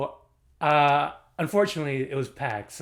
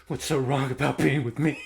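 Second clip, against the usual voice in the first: mock-earnest plea; imitated movie character. Retelling the film character's line, mock-earnest plea. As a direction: put on his pleading voice.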